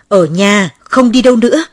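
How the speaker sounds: loud scolding order, pitch jumping up; furious mother. A narrator's reading. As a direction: shout it as a mother forbidding her child. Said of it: "Mẹ lại điên tiết lên". (mẹ quát giận dữ)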